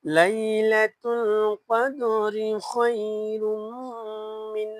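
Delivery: slow, drawn-out chanting; slow recitation. Shown as a demonstration. Teacher reciting the verse for students at home. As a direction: recite slowly, stretching the vowels. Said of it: "বারবার পড়বেন".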